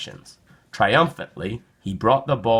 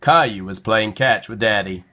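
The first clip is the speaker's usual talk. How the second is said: usual speaking voice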